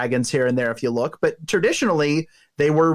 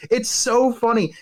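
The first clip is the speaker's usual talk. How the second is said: ordinary talk, nothing marked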